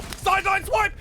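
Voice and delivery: high shouted clutch call; excited. Real, not acted. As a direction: scream the big moment. Clutch call at round end.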